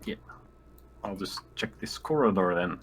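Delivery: normal talking voice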